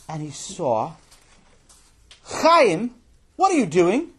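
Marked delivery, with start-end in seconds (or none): 2.2-4.1 high, loud outburst; angry confrontation. Acted. Boy catching his brother in his room, angry and high. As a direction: demand it as an outraged kid.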